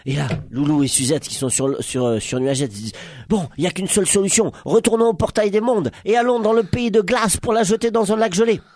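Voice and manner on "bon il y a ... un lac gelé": high, brisk planning voice; rally the group with a plan; meneurs décidés. Acted. Personnages proposent un plan. (voix aiguë et décidée)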